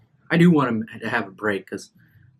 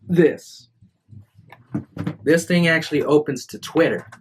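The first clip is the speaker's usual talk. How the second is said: higher, louder eager showing; excited; 5 semitones higher, 3 dB louder than usual. Real, not acted. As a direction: point it out with glee. Showing off the phone.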